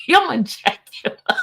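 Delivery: threat dissolving into real laughter; laughing. Real, not acted. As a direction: laugh helplessly through the words. Cracking up at what Robert said.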